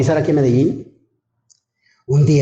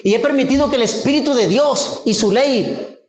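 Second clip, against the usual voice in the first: higher, rising preacher's fervour; fervent preaching; 8 semitones higher than usual. Acted. Preacher exhorting the congregation, raised fervent voice. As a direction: preach it to the congregation with mounting conviction.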